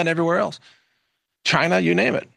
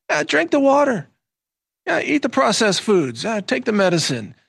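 lightly mocking, higher-pitched encouragement; mock coaxing; 7 semitones higher than usual. AI nudging humans along, mock coaxing. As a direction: egg them on with mock approval, pitched up.

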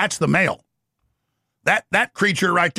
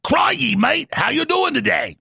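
higher-pitched, badly faked Australian accent; 7 semitones higher, slower than usual. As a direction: attempt a hammy Australian greeting accent. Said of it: "Can't even do the Australian accent".

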